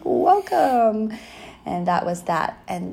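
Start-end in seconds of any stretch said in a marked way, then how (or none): 0.0-1.1 high, loud acted welcome; called-out greeting. Woman high-fives returning missionary, loud high-pitched welcome. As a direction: call it out big and high.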